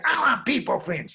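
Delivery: put-on high, loud child's wail; demanding little girl. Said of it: "And the way she said".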